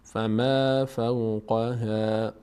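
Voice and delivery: very slow "فما فوقها", vowels thin; light vowels. Shown as a demonstration. Teacher repeats the correct reading slowly, light vowels. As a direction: draw out "فما فوقها" without darkening it. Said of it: "هیچ کدوم پر خونده نمیشه".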